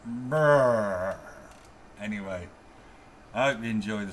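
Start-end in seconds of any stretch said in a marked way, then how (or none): 0.0-1.2 dismissive lip trill. Real, not acted. Dismissive noise.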